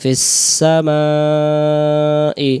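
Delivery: ফিস সামাই with the s joined, very slow; joined fis-samai. Shown as a demonstration. Teacher joins the letters for students, very slow. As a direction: glide ফিস into সামাই, drawn out. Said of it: "আই ইয়াতে চলে আসবে".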